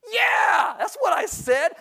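high, loud shouted yeah; defiant shout. Retold or imitated. Teen shouts back at his mom, defiant. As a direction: yell it with no sense left. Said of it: "What do you think I said?".

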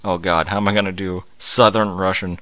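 ordinary delivery

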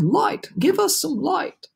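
storybook chorus calling out; crying out. A narrator's reading. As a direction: plead in high, stretched cries. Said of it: "they cried".

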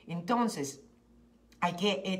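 usual speaking voice

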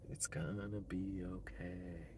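slow, soft whispered reassurance. Real, not acted. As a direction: breathe it out slowly to yourself. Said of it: "whispered repeat".

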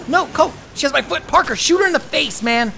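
high, urgent cry for help; panicked shouting. Real, not acted. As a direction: yell to your partner in a panic. Calls to partner when grabbed.

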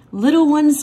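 high, loud circus-barker welcome; ringmaster call. Acted. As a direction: call the little ones in like a ringmaster. Ringmaster teacher calling class.

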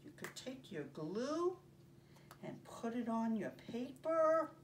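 usual speaking voice

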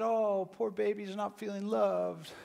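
mocking. Aunts laughing at their nephew's hurt, mocking. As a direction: coo it like taunting a baby. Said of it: "And they were mocking him".

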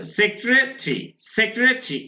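"সেক্রেটারি" as two blocks, sek-re and tri; syllable-split pronunciation. Shown as a demonstration. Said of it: "এভাবে যদি আমরা বলি সেক্রে".

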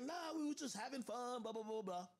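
lightly mimicked carefree shrug; imitating her brush-off. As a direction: brush it off like nothing happened. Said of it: "She was like".

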